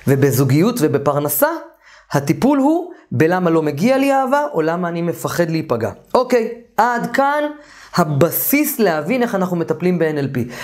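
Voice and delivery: normal talking voice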